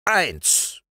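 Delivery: high, falling count; high-voiced drill count; sergeant counting. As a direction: give the firing count as a command. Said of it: "kommandierte der Wachtmeister".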